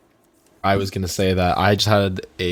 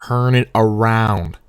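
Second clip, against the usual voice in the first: slow, deliberate bartender command; deadpan serious; 3 dB louder, slower than usual. Retold or imitated. Bartender to the disgusted third guy, slow and serious. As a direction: draw it out dead seriously. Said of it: "in a serious face".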